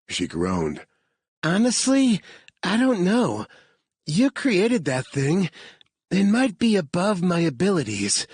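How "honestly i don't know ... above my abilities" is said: lightly voiced tired hacker, unsure; Sammy voice. A narrator's reading. Sammy admitting doubt to Harvard, lightly voiced.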